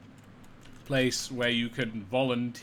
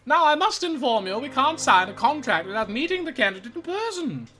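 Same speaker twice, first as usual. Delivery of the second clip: high, chirpy receptionist voice; bright rehearsed service voice; sing-song clerk; 7 semitones higher, 3 dB louder than usual. Acted. The clerk keeps speaking.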